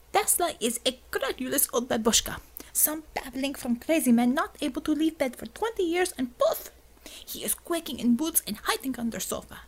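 put-on thick Russian accent, scornful rant. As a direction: sneer it in heavy Russian accent. Xavier ranting in Russian accent.

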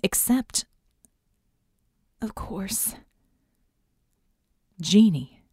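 plain reading voice

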